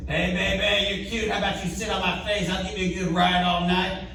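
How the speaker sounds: high, soft come-on in a put-on voice; homeless guy catcalling. Homeless guy propositioning the comic's date, high soft catcall. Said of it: "this homeless guy just goes".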